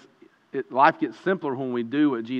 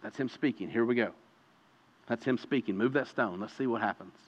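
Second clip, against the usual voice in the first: quiet surrender; 4 semitones lower, 9 dB quieter than usual. Pretend. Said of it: "are you willing to say".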